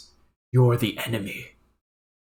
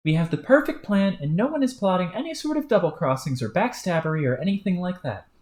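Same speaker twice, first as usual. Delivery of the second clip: higher, hammed-up indignation; mock offended; 8 semitones higher than usual. Troll pretending innocence to his partner, mock offended. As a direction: feign being insulted.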